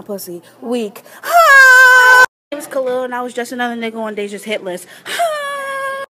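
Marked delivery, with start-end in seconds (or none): 1.2-3.0 high, slow, stretched singsong "My name is"; mock intro. Friend roasting himself in a trend video, high drawn-out intro. 5.1-6.1 high, slow, stretched singsong "My name is"; mock intro. Friend roasting himself in a trend video, high drawn-out intro.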